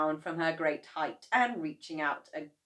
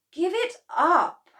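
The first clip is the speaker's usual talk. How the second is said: slow, high, loud dismissal; fed-up wife; 12 semitones higher, 12 dB louder than usual. A narrator's reading. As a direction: tell them to quit. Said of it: "Missus Cranky said".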